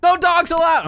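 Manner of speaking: loud, high joking yell; mock shout. Joking at the dog in game, a loud high mock shout. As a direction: bark it out like a fake-stern landlord. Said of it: "I'm just kidding".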